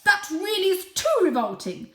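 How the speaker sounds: high, loud, appalled; disgusted mother. Acted. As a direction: recoil in disgust. Mother scolds kitten.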